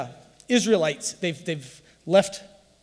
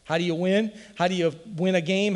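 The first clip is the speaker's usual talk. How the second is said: ordinary talk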